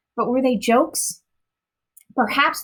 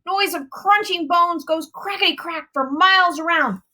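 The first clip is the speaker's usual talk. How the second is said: friendly giant; 9 semitones higher than usual. A narrator's reading. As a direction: boast like a big clumsy giant. Giant voice continues.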